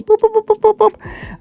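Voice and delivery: very high-pitched rapid boops; playful boop noises. Pretend. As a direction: pop out a string of squeaky boops. Imitated noise while narrating cooking.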